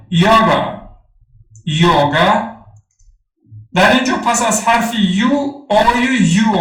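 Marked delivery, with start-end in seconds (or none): 0.1-0.8 یاگا sounded like English "yoga"; English pronunciation demo. Shown as a demonstration. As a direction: say یاگا as "YO-ga", first vowel rounded.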